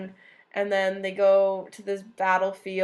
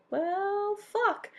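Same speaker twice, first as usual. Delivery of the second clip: much higher, deflated mock dismay; 11 semitones higher than usual. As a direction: squeak it out like a beaten general. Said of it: "he was like".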